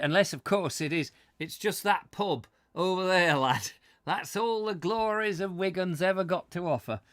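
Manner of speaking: hammed-up northern English voice; northern English accent. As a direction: play the northern English local for laughs. Mocks northern local showing the town.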